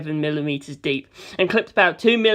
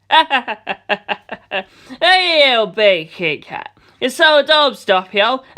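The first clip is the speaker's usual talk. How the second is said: taunting, laughing tease; mocking tease; 5 semitones higher, 4 dB louder, much slower than usual. Mister teasing Doppio over cat whisker scars. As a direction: needle him gleefully.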